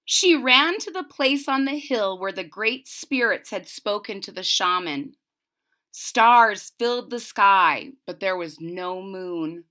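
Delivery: gentle read-aloud storytelling voice; story reading. A narrator's reading. Teacher reading a legend to her class, storytelling. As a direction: read it like a picture book for children.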